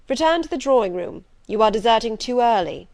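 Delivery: loud, firm order; commanding master. Acted. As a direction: send her back with authority. Rochester orders her back.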